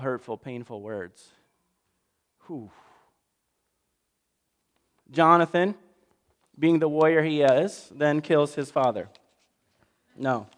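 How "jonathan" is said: ordinary talk, nothing marked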